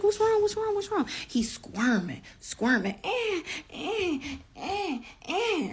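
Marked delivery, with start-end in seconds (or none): 0.0-1.1 high, rapid-fire worried questions; retold panic, pitched way up; alarmed questioning. Mother to her crying baby, high and alarmed. 3.0-5.7 repeated high whiny squeals; imitated baby cry. Mother imitating her squirming one-year-old, high whining cries. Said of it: "he crying like".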